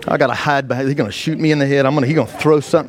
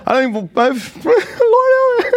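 much higher, squeaking out Lord with a laugh; high whining plea; 3 dB louder, much slower than usual. Retold or imitated. Man begging the Lord, voice breaking up into a laugh. Pleading with God, voice cracking.

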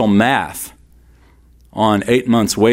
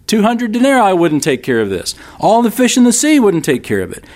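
disciples protesting; 8 semitones higher than usual. Retold or imitated. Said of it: "It sounds like the disciples".

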